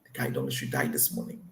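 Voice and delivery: very low, soft, serious tone; concerned. Shown as a demonstration. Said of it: "Sounds concerning".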